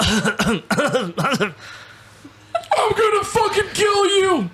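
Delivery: put-on high screeching old man threat; old man shouting. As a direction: screech it like a furious old geezer. Playing an old person.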